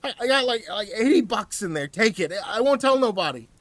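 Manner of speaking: high, loud, scared pleading; desperate hostage offering money; frightened wheeze. Acted. Hostage pleading with the attacker, high and scared. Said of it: "He wheezed".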